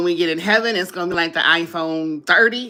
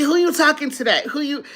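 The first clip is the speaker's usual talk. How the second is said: higher, sassy reproach to the phone; sassy scolding; 8 semitones higher than usual. Retold or imitated. A woman scolding her phone for back talk, sassy. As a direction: scold Siri for talking back. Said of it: "I say".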